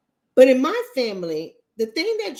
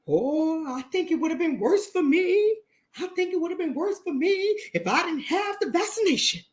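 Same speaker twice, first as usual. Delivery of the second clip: mock relieved believer; 6 semitones higher than usual. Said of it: "those same people turn around and say".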